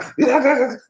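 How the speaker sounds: short high fake blubber; mock crying. Wimp crying in front of people, mock blubber. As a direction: imitate a wimpy blubbering cry. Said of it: "They don't wanna see no crying".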